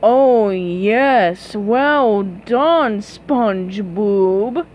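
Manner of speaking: slow sneering fake praise; mock him with fake applause; sarcastic villain congratulation. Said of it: "in a mocking tone".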